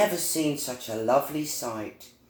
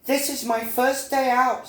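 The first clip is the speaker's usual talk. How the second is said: high little bee; a young bee earnestly explaining; high-pitched storybook character voice; 11 semitones higher than usual. Acted. Little Bee answers cardinal.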